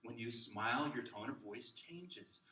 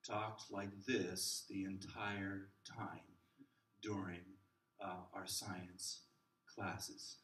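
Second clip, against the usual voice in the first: a hint of a slow, lower droning lecturer; monotone teacher; 5 semitones lower, 4 dB quieter, slower than usual. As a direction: drone on, mildly imitating a dull professor. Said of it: "boring, same tone".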